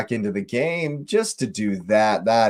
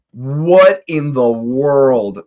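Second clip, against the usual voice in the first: ordinary talk, nothing marked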